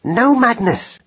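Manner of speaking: high, sharp maternal rebuke; sharp cry. A narrator's reading. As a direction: stop the child short. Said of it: "cried Madeline sharply".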